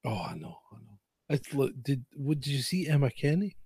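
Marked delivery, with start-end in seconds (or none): none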